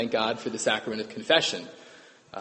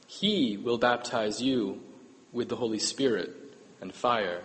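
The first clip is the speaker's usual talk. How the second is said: solemn reading; 3 semitones lower, 5 dB quieter than usual. Acted. Solemn gospel reading, slowing.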